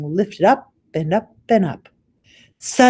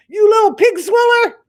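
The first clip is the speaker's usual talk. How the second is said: furious giant; 9 dB louder than usual. Acted. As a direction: roar the insult. Giant rages on.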